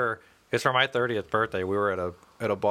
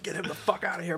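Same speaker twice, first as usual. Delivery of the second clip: higher, held-back imitation of a furious bartender; retold angry order, softer than a real yell; mock pissed-off yell; 6 semitones higher, 5 dB quieter than usual. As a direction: imitate a bartender losing his temper. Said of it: "he's all pissed off".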